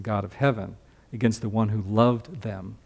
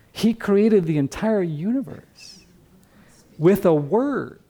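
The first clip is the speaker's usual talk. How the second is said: awed emphasis; 8 semitones higher than usual. Real, not acted. Preacher marvels at creation.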